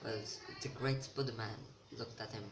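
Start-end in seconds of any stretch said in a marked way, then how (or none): none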